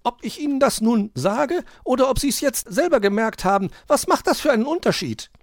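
very high, loud magpie retort; bickering magpie. A narrator's reading. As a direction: argue back in a high bird voice. Elster argues with the fox. (zankende Elster, sehr hoch und laut)

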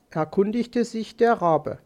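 usual speaking voice

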